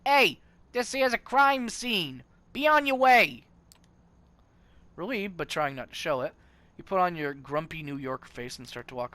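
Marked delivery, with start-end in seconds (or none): 0.1-3.4 loud, high Brooklyn cop yelling; thick Brooklyn accent yell. A narrator's reading. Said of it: "yells in a thick Brooklyn accent".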